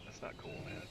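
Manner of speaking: unvoiced soft grumble; whispering. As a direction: breathe it out as a sulky whisper. Whispered aside after panic.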